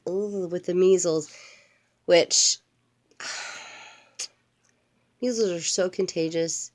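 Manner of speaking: plain reading voice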